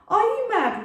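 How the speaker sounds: high, sharp disbelief; incredulous. A narrator's reading. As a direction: scoff at their fear. Eric rebuking frightened men.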